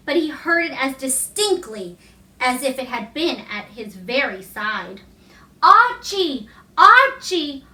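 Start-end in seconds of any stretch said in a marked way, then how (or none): 5.6-7.6 higher, louder call, each name falling away; calling from afar. A narrator's reading. A distant voice calling Archie by the lake, sweet and clear. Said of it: "he heard a voice calling".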